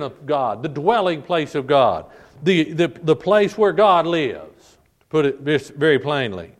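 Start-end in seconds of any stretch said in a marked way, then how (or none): none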